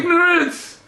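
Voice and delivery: exclaiming at his own ignorance; exasperated outburst. Real, not acted. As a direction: blurt it out, amazed at his own blindness. Scolding himself over missed translation.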